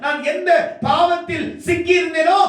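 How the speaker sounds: high, sustained shouted declamation; full-force preaching. Real, not acted. Preacher testifies to being lifted out of sin, full-force preaching. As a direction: pour it out on one high pitch, building relentlessly.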